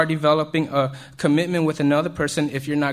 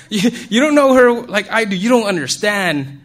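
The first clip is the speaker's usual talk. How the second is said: loud, high-pitched mock-indignant protest; 5 semitones higher, 7 dB louder than usual. Lovestruck guy insisting to friends, in a loud mock protest. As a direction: blurt it out high and loud, hamming it up. Replays the lovestruck retort, louder.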